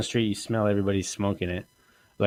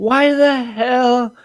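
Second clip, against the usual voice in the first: slow, much higher and louder disbelieving why; exasperated outburst; 13 semitones higher, 7 dB louder, much slower than usual. Acted. Complaining to his co-host about bread packs, slow, high and loud disbelief. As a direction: stretch it out in comic outrage. Outraged over bread packaging.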